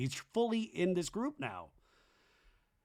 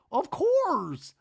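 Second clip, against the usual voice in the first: high, drawn-out cooing reply; mock reassurance; 11 semitones higher, 5 dB louder than usual. Brittany answering drunk Zach, high mock reassurance. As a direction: put on a high placating voice.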